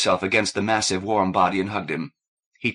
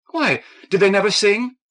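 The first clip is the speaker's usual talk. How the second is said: surprised; 12 semitones higher, 7 dB louder than usual. Acted. Kathleen surprised reply.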